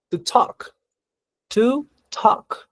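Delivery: "talk" said as tok, no L sound; "To talk" with a mute L; silent L in talk. Shown as a demonstration. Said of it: "Noten que no pronuncio to talk".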